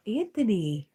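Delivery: loud high gasp of a name; playfully scandalized, call him out; mock outrage. Real, not acted. Catching a chatter teasing, calling his name loudly.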